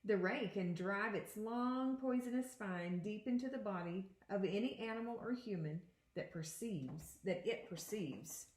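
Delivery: put-on expressive read-aloud to children; picture book read to students; reading aloud to a class. Teacher reads picture book aloud.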